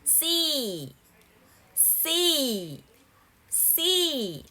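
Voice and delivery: See said slowly with a long clear ee; teacher modelling See, ee held long; model word repeated. Shown as a demonstration. Teacher drilling See for students, slow and clear. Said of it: "Listen and say these words".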